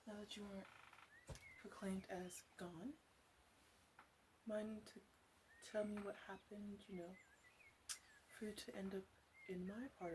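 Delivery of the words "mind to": normal talking voice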